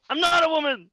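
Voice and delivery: high, loud indignant protest; indignant shout. Acted. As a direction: shriek it in character, offended. Andrew protests on waking.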